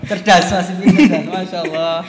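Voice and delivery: memuji "Cerdas" sambil tertawa keras. Real, not acted. Praising while laughing. (tertawa geli memuji lawan bicara)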